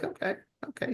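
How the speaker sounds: soft, high whispered "okay, okay"; hushed high mock reply; breathy whispered okay. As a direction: agree under your breath, a little bewildered. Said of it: "And I was like".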